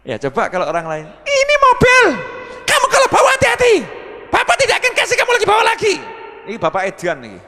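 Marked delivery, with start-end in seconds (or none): 1.3-5.9 high, loud scolding shout; angry father. Retold or imitated. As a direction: yell at the son like a strict father. (bentakan ayah yang marah)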